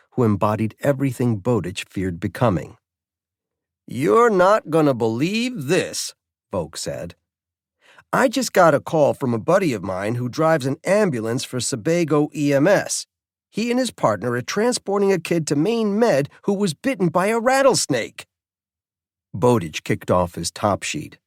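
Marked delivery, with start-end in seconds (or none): 3.9-6.1 higher, slow teasing drawl; a jaded colleague on the phone; cynical warden. Acted. Cynical warden Volk voiced. 8.1-18.3 higher, jaded colleague voice; a world-weary warden calling late at night; cynical warden. Acted. Volk on phone.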